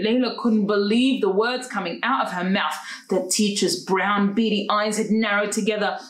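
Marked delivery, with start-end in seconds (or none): none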